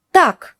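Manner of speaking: Так snapped high and sharp; sharp stern tak. Shown as a demonstration. Teacher showing learners a sharp stop-it Так. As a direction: cut them off with a high Так. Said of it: "«Enough, stop".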